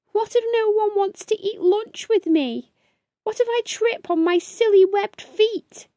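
put-on fretful young beaver fretting aloud; worried little beaver. Young beaver frets about school in a high worried voice.

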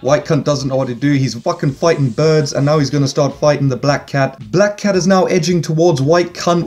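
ordinary delivery

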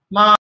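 MA held long on a high, level pitch; first tone. Shown as a demonstration. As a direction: say MA high, sustained and perfectly level. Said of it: "dari nada satu".

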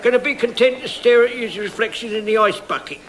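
high-pitched comic waiter; waiter character. Acted. Waiter interrupts the diners.

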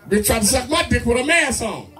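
ordinary talk

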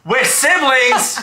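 high, stretched-out incredulous question; mock shock. Reacting to a hate comment that calls the couple siblings, mock shock. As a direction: act scandalised, pitch shooting up.

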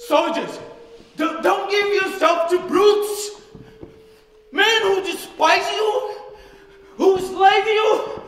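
impassioned shouting, pitched high; roaring appeal to the troops; ranting dictator speech. Acted.

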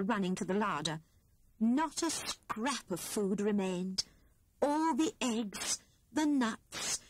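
ordinary delivery, nothing marked

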